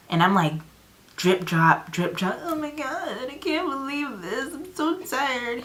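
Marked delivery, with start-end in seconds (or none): 2.4-5.6 mock weary whine. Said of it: "acting lost stranger in truck".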